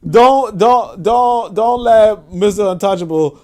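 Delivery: high, loud, amused pleading with repeated false starts; laughing protest. Real, not acted. Host teasing a commenter about Mister Untouchable, laughing protest. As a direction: plead loudly through rising laughter.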